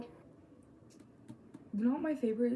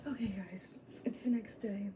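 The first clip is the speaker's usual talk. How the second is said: hushed update; real soft whisper; 2 semitones lower, 6 dB quieter than usual. Real, not acted. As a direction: whisper quietly to the camera. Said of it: "didn't want them near me talking".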